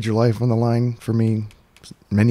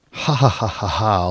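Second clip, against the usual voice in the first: mock villain laugh. Mocking laughing ghost back.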